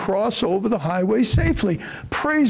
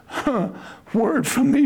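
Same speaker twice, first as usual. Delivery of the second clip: ordinary delivery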